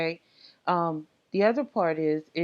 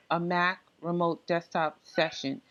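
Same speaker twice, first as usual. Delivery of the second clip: ordinary talk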